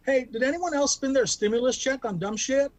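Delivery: normal talking voice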